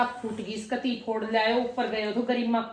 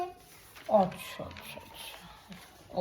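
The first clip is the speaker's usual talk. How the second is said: whispered okay okay; hushed okay; 9 dB quieter, much slower than usual. Real, not acted. Mother whispers okay to her son's story. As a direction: breathe it almost silently.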